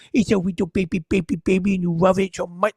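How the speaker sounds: put-on gooey doting pet-owner baby voice; mock baby talk. Comic mocking doting dog owners, cooing baby talk.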